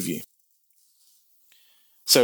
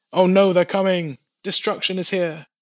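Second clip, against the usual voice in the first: alarmed cry; 7 semitones higher than usual. Acted. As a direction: shout the alarm as they come. Soldier sees the enemy coming.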